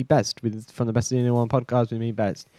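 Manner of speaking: usual speaking voice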